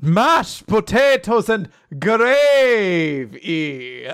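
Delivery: slow, hammed-up Transylvanian vampire accent. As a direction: drawl it like a mock Dracula. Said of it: "the fake vampire accent".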